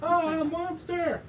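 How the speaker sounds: very high, drawn-out mock terror; alarmed cry. Reader voices character sighting a monster, terrified. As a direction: cry out in storybook fright.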